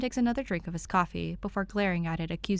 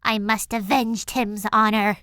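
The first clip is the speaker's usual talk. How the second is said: higher and louder, mock heroic; grand declaration; 3 semitones higher, 8 dB louder than usual. A narrator's reading. Danny declares she'll avenge Tim's honor, mock heroic. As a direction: proclaim it like a knight's oath. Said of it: "Danny declares".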